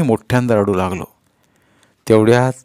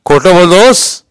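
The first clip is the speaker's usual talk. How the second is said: loud, high challenge; intimidating interrogation; accusing inspector; 8 semitones higher, 8 dB louder, slower than usual. A narrator's reading. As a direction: catch the child in a lie. Inspector accuses boy of lying.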